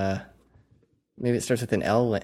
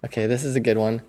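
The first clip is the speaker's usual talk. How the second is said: ordinary talk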